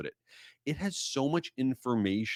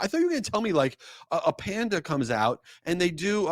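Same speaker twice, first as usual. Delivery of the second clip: high, incredulous complaint, easing down toward the end; exasperated rant; 6 semitones higher than usual. Retold or imitated. About the waiter's question, high exasperated rant. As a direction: vent at the pointless question. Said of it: "I'm like".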